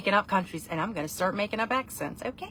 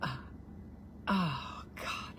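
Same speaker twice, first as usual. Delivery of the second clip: normal talking voice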